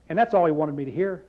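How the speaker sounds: ordinary talk, nothing marked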